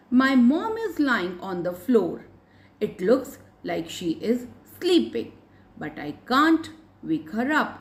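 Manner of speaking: put-on very slow, measured reading for young learners; story read-aloud. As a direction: read it out phrase by phrase, slowly. Reads story aloud to pupils. (बच्चों के लिए धीरे-धीरे कहानी पढ़ना)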